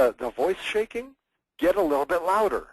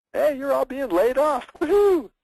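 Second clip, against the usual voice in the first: high, loud fake cheer over bad news; misplaced cheer; 12 semitones higher, 5 dB louder than usual. Presenter mocks cheering while announcing layoffs, high and loud. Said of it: "that's not when you do it".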